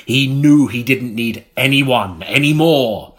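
normal talking voice

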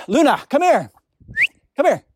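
calling the dog back, loud and sharp. Real, not acted. Speaker calling the dog Luna away from deer, loud call. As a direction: shout across the hillside for the dog.

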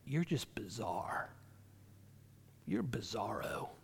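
slow, hushed near-whisper; soft, whispery imitated put-down; retold sibling taunt. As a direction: imitate a taunt, low and drawn out. Retelling a sibling's hurtful nickname.

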